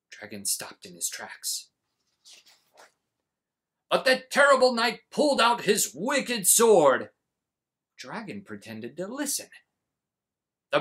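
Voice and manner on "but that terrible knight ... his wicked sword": loud, high dramatic reading; booming read-aloud. A narrator's reading. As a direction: boom the tale so the dragon hears. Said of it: "read aloud in a booming voice".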